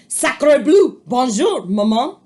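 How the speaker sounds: put-on loud French-accented duckling; French baby duck. A narrator's reading. Baby duck greets Jack, loud French accent. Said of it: "and said".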